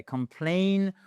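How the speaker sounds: 'complain' with weight on 'plain'; stress on second syllable. Shown as a demonstration. Teacher showing where the stress mark falls, second-syllable stress. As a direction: land firmly on the end of 'complain'. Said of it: "notre accent tonique est juste après".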